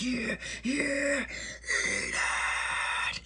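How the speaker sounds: high, strained taunt; mock gloating. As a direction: squeeze out the jeer as you grind the curse in. Gloating while digging in with spell.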